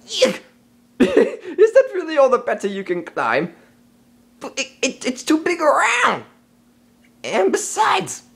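ordinary talk, nothing marked

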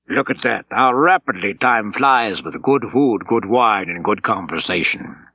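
a hint of an affable German officer savoring the meal; lightly voiced commander at lunch, relaxed. Von Eschel remarks after lunch.

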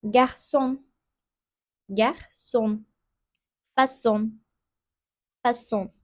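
"Garçon" and "façon" with an s sound for ç. Shown as a demonstration. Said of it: "Ç harfini de S olarak okuduk". ("garçon" ve "façon" sözcüklerinde Ç, S gibi)

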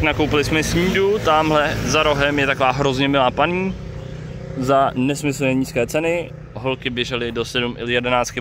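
ordinary talk, nothing marked

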